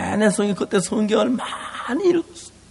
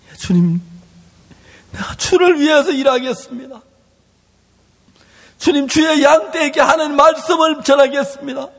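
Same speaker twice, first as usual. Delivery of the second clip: tearful vow; 5 semitones higher than usual. Acted. Peter's tearful vow to Jesus.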